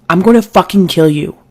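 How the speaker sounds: mild mock shout on adrenaline; restrained adrenaline shout. A narrator's reading. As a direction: act out a held-back furious shout. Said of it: "shouting through sheer adrenaline".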